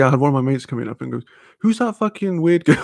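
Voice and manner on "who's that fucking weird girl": high-pitched disbelieving question; a mate at the party, high and incredulous; mock incredulous mate. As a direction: ask it like you can't believe your eyes. Mate asking about the weird girl.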